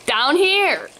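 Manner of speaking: high-pitched call for attention; lifted, hailing voice. A narrator's reading. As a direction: call to someone who can't spot you. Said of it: "Mister Boring calls".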